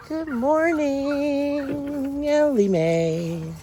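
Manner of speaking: very slow, stretched good morning; drawn-out greeting. Real, not acted.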